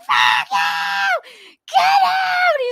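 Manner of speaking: shrieked high and loud, Fuck you, Get out; screaming fury. Retold or imitated. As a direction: scream it at him in a hammed-up rage. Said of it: "and she's like".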